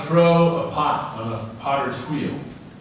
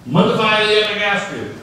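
higher, louder take; 6 semitones higher, 5 dB louder than usual. Shown as a demonstration. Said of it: "what tone of voice you say".

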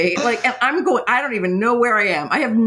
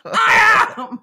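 much higher and louder I am; emphatic agreement; 10 semitones higher, 4 dB louder, slower than usual. Retold or imitated. Soaked rider answering the doorman, loud exasperated yes. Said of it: "And I'm like".

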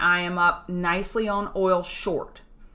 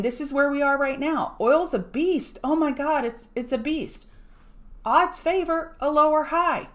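excited awe; 7 semitones higher than usual. Real, not acted. Telling viewers oil is a beast, awed and excited. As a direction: marvel at the rally, voice rising.

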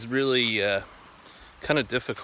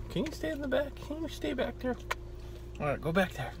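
talking to a dog; 13 semitones higher, 8 dB quieter than usual. Real, not acted. Tells dog to stay back.

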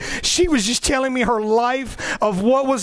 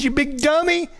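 teasing voice of God; 4 semitones higher than usual. Retold or imitated. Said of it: "and God says".

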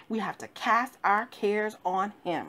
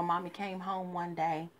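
normal talking voice

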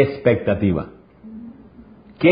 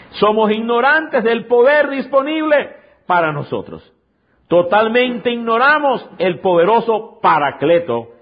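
raised, high preaching cry; preaching at full force; 8 semitones higher than usual. Acted. Pastor preaching hard to the congregation, raised and high. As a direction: proclaim it to the whole congregation. Otra vez, repito.

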